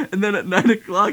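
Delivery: ordinary talk, nothing marked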